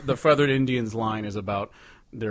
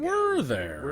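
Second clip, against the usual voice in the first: a much higher, teasing question; mock surprise; 11 semitones higher than usual. Cohost teasing about the many new albums, mock surprise. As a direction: feign surprise at the news.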